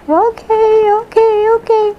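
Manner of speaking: squeaky high, quick agreement; high eager okays. Retold or imitated. To her relatives, a squeaky high eager okay. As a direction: pipe up high and eager like a girl.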